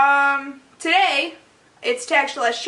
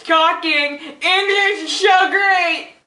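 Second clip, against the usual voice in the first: high, slow, goofy drawl; goofy mock voice; 6 semitones higher, 4 dB louder, much slower than usual. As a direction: play dumb about your own words. Jokey self-mocking aside.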